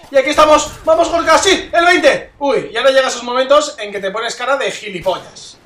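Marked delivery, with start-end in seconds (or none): 0.1-2.3 loud, high rallying shout; triumphant cheer. Real, not acted. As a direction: cheer yourself on, pumped. Cheering himself at twentieth kill.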